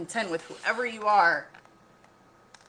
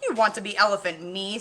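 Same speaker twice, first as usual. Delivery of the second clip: sneering king; 3 semitones higher, 7 dB louder than usual. A narrator's reading. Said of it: "sneered the king".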